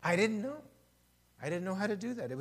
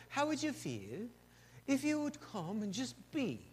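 gentle British English imitation, softer; British accent; 5 semitones higher, 6 dB quieter than usual. English leaders inviting the pastor to rest, in a soft British accent. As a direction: ask it softly in a British accent. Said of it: "with their British accent".

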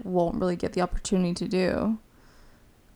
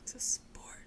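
whisper; 10 dB quieter than usual. Acted. Joins the dramatic whisper.

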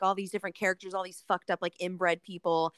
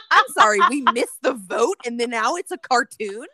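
outraged retort; 3 semitones higher, 11 dB louder than usual. Retold or imitated. Outraged quote at park screening.

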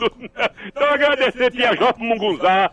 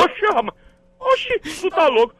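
pitched-up indignant exclamation; high indignant oxi; 8 semitones higher than usual. Acted. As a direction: squeal back indignantly. Guard squeals back. (indignação aguda)

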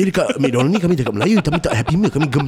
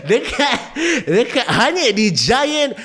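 mall MC voice; 10 semitones higher than usual. Retold or imitated. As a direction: grill the kid like a cheesy mall MC. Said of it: "the M Cs at the Giant".

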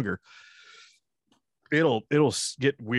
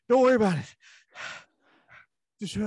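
high, puffing brush-off; out of breath; 11 semitones higher, faster than usual. Pretend. Winded man dodging his friend's question, mock breathless. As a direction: pretend to be winded, pushing words out between breaths. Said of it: "Why are you so out of breath?".

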